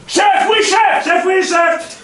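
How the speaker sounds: high, loud military yell; gung-ho soldier shout. Acted. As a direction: shout it like a keen recruit. Recruit answering drill sergeant.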